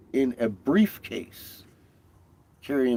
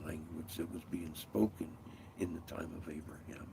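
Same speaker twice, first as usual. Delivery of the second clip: hushed; 5 semitones lower, 10 dB quieter than usual. Real, not acted. Drops to near whisper conspiratorially.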